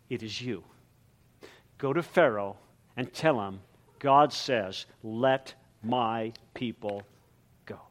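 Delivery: low, soft and intimate; quiet commissioning. Acted. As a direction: speak it gently to one man. God quietly commissioning Moses.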